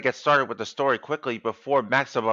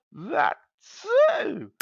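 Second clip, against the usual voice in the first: sudden high sneeze burst; 6 dB louder than usual. Acted. Sick man sneezes, high and loud burst. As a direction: explode into a sneeze.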